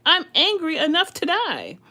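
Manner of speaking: high, loud angry outburst; furious reply. Acted. Jonah answers God back in a loud rage.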